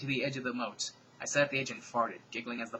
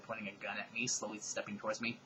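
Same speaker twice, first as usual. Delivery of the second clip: normal talking voice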